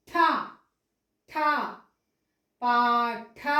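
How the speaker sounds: ఠ with a puff of air, also in పాఠ; aspirated retroflex tha. Shown as a demonstration. Teacher swapping the letter for pupils, aspirated ఠ. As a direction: push breath out after the hard ఠ. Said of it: "ఒకవేళ ఇక్కడ ఠ పెట్టామనుకోండి".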